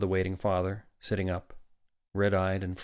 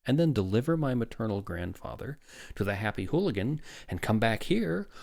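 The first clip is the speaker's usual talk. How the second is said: higher, lilting sarcastic breeziness; mock carefree; 6 semitones higher than usual. A narrator's reading. Father explains the grim choice, mock carefree. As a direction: make it sound so simple, climbing at the ends. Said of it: "to symbolize carefree simplicity".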